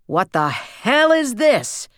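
slow, high, loud roar of fury; father roars at what he's found. A narrator's reading. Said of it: "he roared".

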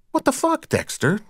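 a sudden high, accusing outburst; accusingly. A narrator's reading. Deborah to Dexter, blaming him, high and accusing. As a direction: fling the blame at him. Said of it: "Deborah said accusingly".

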